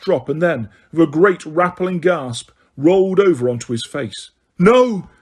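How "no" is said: loud, higher cry of shock; shout. A narrator's reading. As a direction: shout it in horror. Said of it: "shouted Harry".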